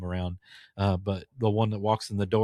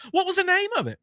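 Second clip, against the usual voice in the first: Lady Boomhauer; 3 dB louder than usual. Retold or imitated. Customer demands a piano in a high, loud Boomhauer voice. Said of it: "she sounds just like".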